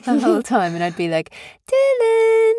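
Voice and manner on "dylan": high-pitched sing-song wake-up call; coaxing mother. Retold or imitated. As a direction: call a sleeping baby in a very high voice. Mother calling baby to wake.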